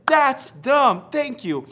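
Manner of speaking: high loud thanks; overjoyed to get them back; excited relief. Retold or imitated. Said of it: "I said".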